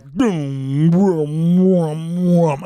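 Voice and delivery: slow, pulsing boom sound effect; woozy booms like a warping head rush; imitated tripping whoom. Imitates the warped sensation of tripping.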